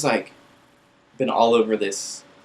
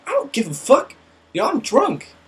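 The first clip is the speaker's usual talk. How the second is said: put-on drunk defiance; 14 semitones higher, 5 dB louder than usual. Drunk drummer dismissing them.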